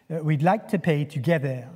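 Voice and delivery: mock French accent on an English line. Husband answering the hotel receptionist in a mock French accent. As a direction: put on a hammed-up French accent. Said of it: "in my very best French accent".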